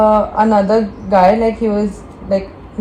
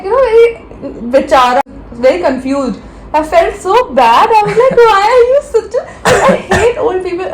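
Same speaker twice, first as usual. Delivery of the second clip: worked-up rant; 7 semitones higher than usual. Real, not acted. Retelling the airport quarrel, pitched-up indignant rant. Ranting, outraged at the uncle.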